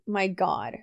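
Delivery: usual speaking voice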